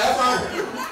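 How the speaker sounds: usual speaking voice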